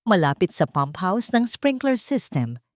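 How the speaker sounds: plain reading voice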